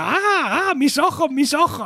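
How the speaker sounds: high-pitched mock shriek, volume held in; imitated hysterical screaming. Host retelling Phoebe seeing the couple, mock hysterical. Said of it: "comienza a gritar histérica".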